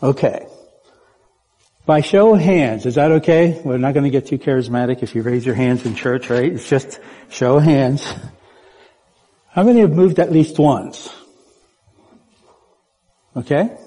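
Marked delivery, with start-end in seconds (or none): none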